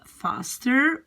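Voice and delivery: normal talking voice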